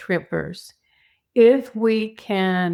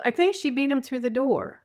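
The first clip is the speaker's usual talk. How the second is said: ordinary talk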